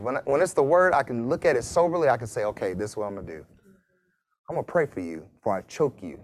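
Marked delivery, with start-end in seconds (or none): 2.4-6.2 softer, lower, quick mock calm; deadpan the punchline, slightly hushed; mock calm resolve. Preacher joking to the congregation, in a mock calm voice. Joke about self-control.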